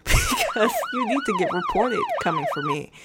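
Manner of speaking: high, laughing through the words. Real, not acted. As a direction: crack up while justifying yourself. Laughs while justifying the report.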